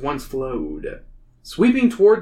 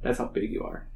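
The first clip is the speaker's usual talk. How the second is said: normal talking voice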